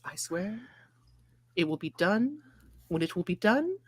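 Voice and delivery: quiet, strained exasperation of a mother; harassed mother. Acted. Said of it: "as any harassed mother would sound".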